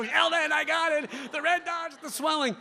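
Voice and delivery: high, frantic, panicked cry. Retold or imitated. Panicked patient bursts into office.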